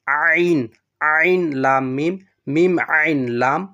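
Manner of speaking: "আইন", "লাম", "মিম" recited loud as exact Arabic letter names; model letter pronunciation. Shown as a demonstration. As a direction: drill "আইন" loudly with a full Arabic Ain. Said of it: "হরফগুলো যেভাবে উচ্চারণ করছি". ("আইন", "লাম", "মিম" জোরে, শুদ্ধ আরবি উচ্চারণে)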